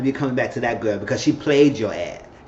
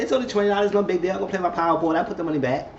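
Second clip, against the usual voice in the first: lightly voiced high; 6 semitones higher, faster than usual. Voicing dad excusing himself.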